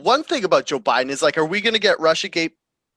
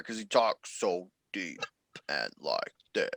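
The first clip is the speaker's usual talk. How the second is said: James Earl Jones impression; 9 semitones lower, 11 dB quieter than usual. Shown as a demonstration. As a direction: drop into a deep rumbling bass. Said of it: "Is that your James Earl Jones impression?".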